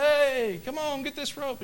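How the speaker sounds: high, loud shout up to the roof; calling out. Pretend. Helicopter rescuers calling to the stranded man, shouted. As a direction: holler over the rotor noise.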